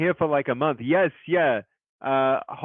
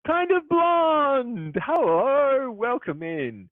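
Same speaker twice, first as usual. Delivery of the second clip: high, loud, drawn-out welcome; excited raid greeting; 13 semitones higher, 3 dB louder, much slower than usual. Real, not acted. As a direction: call out the raider's name with joy.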